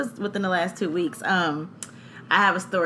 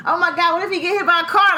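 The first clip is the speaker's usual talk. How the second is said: high, loud and fast panic; panicked; 11 semitones higher, 6 dB louder, faster than usual. Retold or imitated. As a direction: blurt out the worst fear. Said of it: "my mind was just going crazy".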